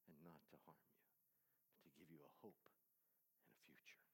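soft unvoiced whisper; whispered verse. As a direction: let the promise fade to a hush.

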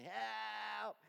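high, loud cry of Help!; screaming for help. Retold or imitated. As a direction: yell it like a man hanging on a rope. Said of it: "and he's screaming".

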